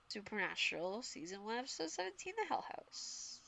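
creepy whispered title card; spooky whisper. Acted. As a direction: hush it like a haunted-house trailer.

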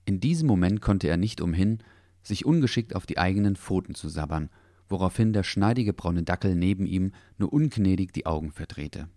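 usual speaking voice

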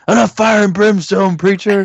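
high, exasperated mock shout; John Goodman. Film fan quoting the agent to the preacher, exasperated. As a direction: cut the preacher off, fed up. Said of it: "but John Goodman's like".

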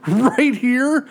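hammed-up angry threat; mock fury. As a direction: act out being furious, stretching out each word. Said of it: "if I was ever so mad".

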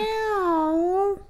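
long, very high mewl; drawn-out high cat wail; whiny cat meow. Acted. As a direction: whine it out like a complaining cat. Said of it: "He's a whiny cat".

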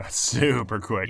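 drawn out slowly, mock-amazed; slow exaggerated. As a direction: stretch each word out for effect.

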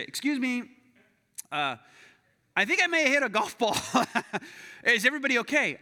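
high, loud, hesitant call; sheepish calling out. Retold or imitated. Golfer calls to the apartment he hit, sheepishly. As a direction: call over politely with a nervous laugh.

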